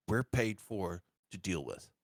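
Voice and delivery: ordinary talk, nothing marked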